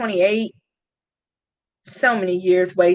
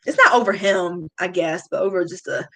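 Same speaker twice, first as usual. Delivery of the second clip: ordinary talk, nothing marked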